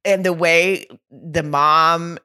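ordinary delivery

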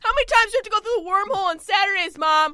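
high, fast whiny complaint; whiny teen voice. Acted. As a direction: whine like a bratty kid.

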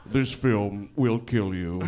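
very slow, low, ominous voice; mock ominous warning. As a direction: deliver a doom warning, low and drawn out.

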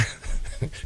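very soft, breathy chuckle; short chuckle. Real, not acted. Host amused recalling wedding reception food, short chuckle. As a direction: chuckle briefly under the breath. Chuckles over forgotten dish name.

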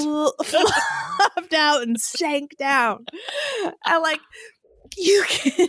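giggling through the words, slow; cracking up mid-sentence; laughing. Real, not acted. Giggling over a crude joke.

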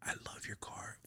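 hushed, breathy longing; a secret little sigh of envy; envious whisper. Retold or imitated. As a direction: whisper it like you're coveting something. Wistful envy over neighbor's car.

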